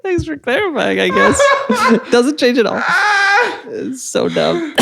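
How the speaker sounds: laughing hard through the words. Real, not acted. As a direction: talk while cracking up. Cracking up at lisp joke.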